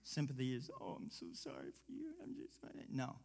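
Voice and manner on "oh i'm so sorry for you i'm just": pitying murmur. Retold or imitated. Mimicking pitying voice.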